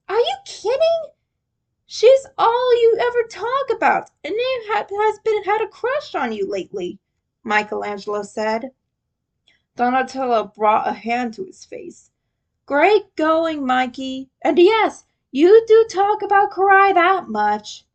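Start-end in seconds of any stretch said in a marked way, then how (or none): 0.1-7.0 much higher, lightly voiced excitable kid brother; teasing disbelief in a light voice; Mikey calling out Leo about Karai; Mikey's voice. A narrator's reading. 12.7-17.8 higher and louder, exasperated brainy brother; a hint of a sighing know-it-all; Donatello's voice. A narrator's reading.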